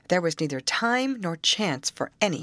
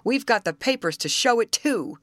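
higher-pitched, defiant growl; 7 semitones higher, 4 dB louder than usual. A narrator's reading. Camp leader defending his claim to the rescuers, growled. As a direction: insist indignantly, voice lifted. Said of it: "growled the leader of the campers".

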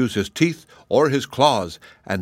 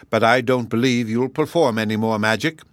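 plain reading voice

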